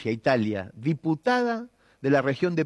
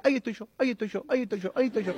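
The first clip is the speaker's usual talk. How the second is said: put-on quick, softer chant of a boy pointing at the credits; lightly voiced smug boy at the cinema; proud classmate; 4 semitones higher, 4 dB quieter, much faster than usual. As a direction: rattle it off like a smug kid spotting his name. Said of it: "Juan Pérez decía".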